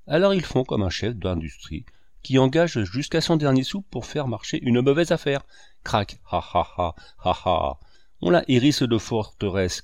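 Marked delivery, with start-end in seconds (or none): 5.8-7.8 mocking laugh. A narrator's reading. Fauvarc laughs at collapse.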